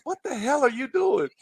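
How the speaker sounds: high-pitched retold outburst of disbelief; indignant coach. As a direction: imitate a coach's exasperated demand, pitched high. Coach confronting player, retold.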